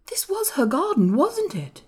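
high-pitched, wondering question; wondering boy. A narrator's reading. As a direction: ask it in a boy's high voice. Colin asking about his mother's garden.

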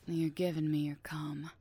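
very low, hushed and controlling; whispered command. Acted. Hushed orders to partner.

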